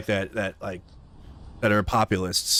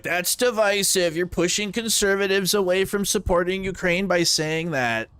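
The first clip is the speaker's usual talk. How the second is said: high mocking whiny voice; high nasal-free mock of people calling him divisive; mocking critics; 9 semitones higher than usual. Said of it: "And people were like".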